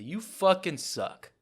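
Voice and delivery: slow, loud furious bark; angry dad yell. Retold or imitated. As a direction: yell it at your kid. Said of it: "He said".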